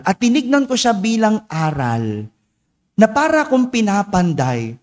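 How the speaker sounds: normal talking voice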